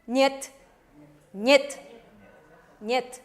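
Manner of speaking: niet said as net, short and clipped; Russian niet. Shown as a demonstration. Teacher modelling niet for the class. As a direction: say niet like net, a single syllable. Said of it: "¿cómo se lee?".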